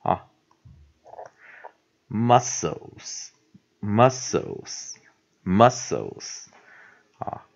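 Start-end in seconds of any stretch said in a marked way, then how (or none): 2.1-6.5 MUSCLES very slowly as muss-ols; slow pronunciation demo. Shown as a demonstration. Teacher marking the word once more, slow pronunciation demo. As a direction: repeat MUSCLES with long pauses, two clear syllables. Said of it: "再标一次哈".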